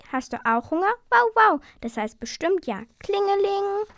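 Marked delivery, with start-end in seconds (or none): 1.1-1.6 high, loud mock woof; dog bark. Dog answers with barking. 3.0-3.9 high, sing-out ringtone; imitated phone ring.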